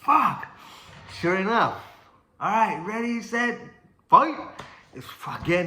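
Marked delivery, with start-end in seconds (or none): 0.0-0.5 high, loud mock cry of despair; dismayed outburst. Boxer learning the fight goes on, dismayed yell. 2.4-4.4 high call rising to a loud last word; referee's start call. Retold or imitated. Ref restarting the bout, high rising shout.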